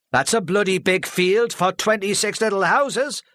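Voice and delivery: loud, high heckle from the back; shouting. Acted. A heckler shouting at the speaker from the back, loud. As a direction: bellow it across the hall. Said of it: "Charlie shouted from the back".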